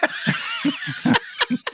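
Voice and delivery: squeaky high-pitched laugh; shrill cartoon cackle; high falsetto cackle. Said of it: "falsetto laugh".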